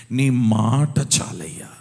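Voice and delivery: loud, slow cry of prayer; cried-out prayer. Acted. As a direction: cry it up to heaven. (గట్టిగా, నెమ్మదిగా మొరపెట్టడం)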